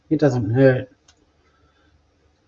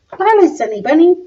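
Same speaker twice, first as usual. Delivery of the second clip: cutesy pet voice; 12 semitones higher than usual. Real, not acted. Greeting her cat as it walks in, high baby-talk voice.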